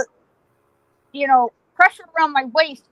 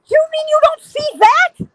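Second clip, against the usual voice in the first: very high, panicked disbelief; incredulous squeal; 13 semitones higher than usual. Retold or imitated. Telling dad about ghosts he can't see, shrieky disbelief. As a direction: squeak the question in acted astonishment.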